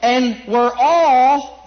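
"all" held long and loud, very slow; stress on all. Shown as a demonstration. Preacher pressing the word all on his hearers. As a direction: stretch out "all" to drive it in. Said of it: "doesn't say just were baptized, but all".

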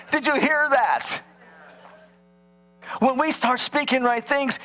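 ordinary talk, nothing marked

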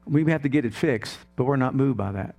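ordinary talk

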